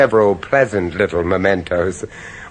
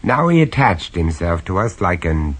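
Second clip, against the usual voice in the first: low-key, wry first-person narration; lightly amused storyteller; story narration; 4 semitones lower than usual. A narrator's reading. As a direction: confide it to the listener.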